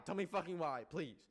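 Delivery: normal talking voice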